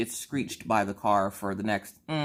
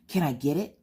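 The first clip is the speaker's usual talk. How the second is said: put-on child voice; 5 semitones higher than usual. Daughter voiced in story.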